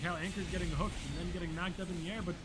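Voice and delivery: high, excited caster call; play-by-play shouting. Shown as a demonstration. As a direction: call the action like live play-by-play. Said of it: "Play-by-play is easy".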